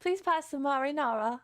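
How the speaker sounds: put-on posh British accent. Streamer showing how posh British sounds, posh British accent. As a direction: ask politely in mock upper-class British. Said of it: "The British one sounds so posh".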